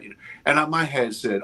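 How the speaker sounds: usual speaking voice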